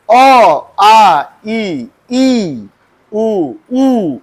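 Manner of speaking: अ, आ, ई, उ, ऊ said clearly with pressure, the long ones held longer; pressed vowels. Shown as a demonstration. Teacher showing correct vowel pronunciation, pressing each vowel. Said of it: "press देना है".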